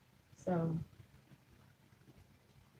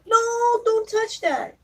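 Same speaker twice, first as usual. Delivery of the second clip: high, louder don't touch that; sharp warning; 12 semitones higher, 3 dB louder, slower than usual. Real, not acted. To a child reaching for something, a sharp high warning.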